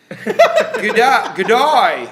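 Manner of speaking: loud, high, laughing Australian "good day"; mock Australian accent. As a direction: ham up an Aussie greeting through laughter. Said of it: "that's all people say in Australia".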